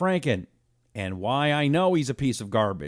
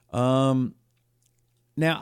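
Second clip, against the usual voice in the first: ordinary talk, nothing marked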